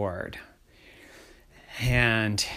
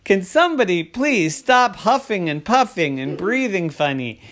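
raised, emphatic exasperation; exasperated dad; 6 semitones higher, 4 dB louder than usual. Acted. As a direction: plead for a straight answer. Dad fed up with gasping kids.

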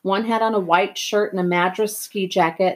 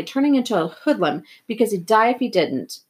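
plain reading voice

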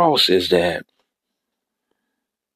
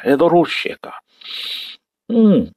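normal talking voice

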